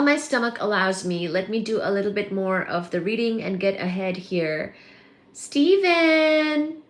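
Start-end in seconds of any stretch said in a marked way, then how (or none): 5.3-6.8 high, loud, drawn-out name call; excited sing-out of a name; delighted greeting. Real, not acted. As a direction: call out to a friend who just arrived. Calling out to arriving viewer.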